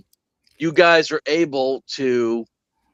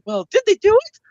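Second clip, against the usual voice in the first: much higher, loud questioning squeak; high squeaky voice; 4 dB louder than usual. Retold or imitated. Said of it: "it was like".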